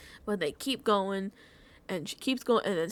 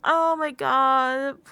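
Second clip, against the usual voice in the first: loud, drawn-out wail; playful exaggerated lament; mock bawling; 3 semitones higher, 8 dB louder, much slower than usual. Real, not acted. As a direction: stretch it slowly and loudly in overwhelmed distress. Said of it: "I, like, was bawling".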